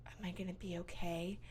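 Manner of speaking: weak whispered question; dying First Lady. Acted. Dying First Lady asks in hospital, whispered. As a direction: whisper it like a patient fading away. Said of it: "the First Lady's like".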